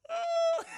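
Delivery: short, very high frightened squeal; hammed-up high scream; mock scream. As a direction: squeak it like a terrified child. Traumatized girl in corner.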